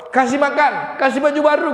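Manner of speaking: high, loud storytelling; loud, high, impassioned narration; emphatic retelling. Acted. Retells the Prophet's giving, emphatic.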